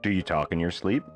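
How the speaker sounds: ordinary delivery, nothing marked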